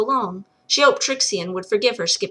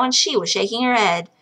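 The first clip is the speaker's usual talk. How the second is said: usual speaking voice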